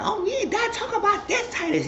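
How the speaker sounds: squeaky high-pitched woman's voice, laughing through it; high falsetto woman. Retold or imitated. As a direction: put on a high female voice with a giggle. Woman character voiced in recap.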